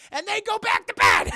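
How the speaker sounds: high, loud burst; mock exasperated shout. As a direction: yell it out for comic effect. Teller shouts the outcome.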